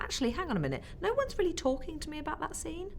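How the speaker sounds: high-pitched, retold puzzled realization; retold confusion. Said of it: "getting a bit confused".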